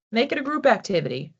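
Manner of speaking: ordinary talk, nothing marked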